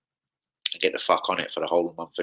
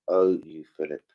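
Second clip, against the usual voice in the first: ordinary talk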